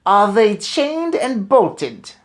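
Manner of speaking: put-on high, loud, very slow witch voice with a foreign accent; Grand High Witch demanding. A narrator's reading. Witch leader demanding of her witches, slow and loud. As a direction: drag each word out like a menacing hag. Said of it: "shouted the Grand High Witch".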